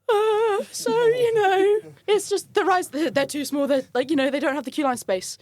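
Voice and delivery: high-pitched flustered explaining; nervous. Retold or imitated. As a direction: squeak out an excuse under a menacing stare. Nervously explaining to intimidating customer.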